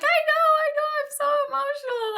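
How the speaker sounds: high, teary voice, fast on the second line; tearful joy. Retold or imitated. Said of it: "I start to, like, cry".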